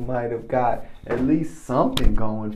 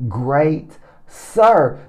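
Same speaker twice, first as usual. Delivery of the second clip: normal talking voice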